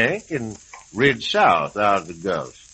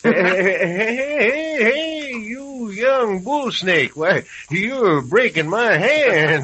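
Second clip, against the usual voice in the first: high, laughing yelps of protest over a crushing handshake; old man laughing in pain, voice pitched way up; 9 semitones higher, 4 dB louder, slower than usual. Acted. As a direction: laugh it out while your hand gets squeezed. Handshake too hard, laughing protest.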